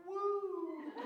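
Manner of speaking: high, loud "woo"; high-pitched celebratory woo; imitated whoop. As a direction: let out a long high party whoop. Imitates Tammy's whoop at immigration.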